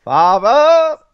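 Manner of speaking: loud high shout; shouted call. Retold or imitated. Quoting I T Crowd.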